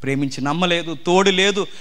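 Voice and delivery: ordinary talk, nothing marked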